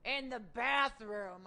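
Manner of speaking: high, loud tipsy mother voice; drunk mom. Retold or imitated. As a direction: play the drunk mom, loud and slurring slow at the end. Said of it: "called all drunk".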